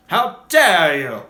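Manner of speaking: loud, slow affronted "How dare you"; Colin Baker outrage. Retold or imitated. Fan imitates Colin Baker's Doctor taking offence, loud and slow. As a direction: stretch it out, deeply offended. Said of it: "That would be Colin Baker".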